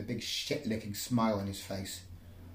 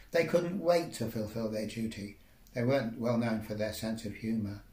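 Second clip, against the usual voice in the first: usual speaking voice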